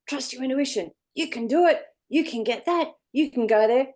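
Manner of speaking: high, cheering inner coach; encouraging shoulder voice. Retold or imitated. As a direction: pump yourself up, brightly rising. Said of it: "this shoulder's going".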